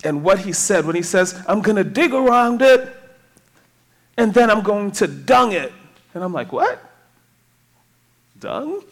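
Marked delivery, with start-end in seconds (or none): 1.5-5.7 lightly imitated Jamaican preacher, louder; imitated Jamaican accent. Retelling a Jamaican preacher's verse, light Jamaican accent. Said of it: "He did it in his Jamaican accent". 6.5-8.8 puzzled surprise. Retold or imitated. Preacher recalling hearing the island word, mock baffled. Acts out own puzzled reaction.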